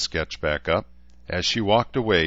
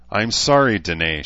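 ordinary delivery

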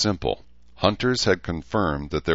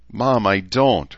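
slow, higher, louder, holding in a retort; choking back anger; 6 semitones higher, 6 dB louder, slower than usual. A narrator's reading. Daughter holding back a hot retort to her mother, restrained anger. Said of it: "choking a hot retort".

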